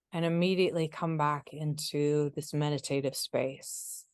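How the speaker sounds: guided meditation. Acted. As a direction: ease them into stillness, unhurried and hushed.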